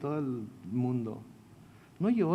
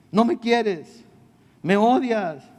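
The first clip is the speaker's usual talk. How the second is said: hammed-up hurt wife, higher and louder; mock tearful wife; 6 semitones higher, 7 dB louder, much slower than usual. Wife accusing Samson, playing a mock-weepy reproach. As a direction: wail it at him like a sulking bride.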